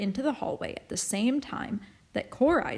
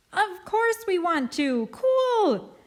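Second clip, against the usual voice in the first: high, loud joyful shout; excited children; 11 semitones higher, 5 dB louder, slower than usual. A narrator's reading. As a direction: cheer like happy kids. Children cheer.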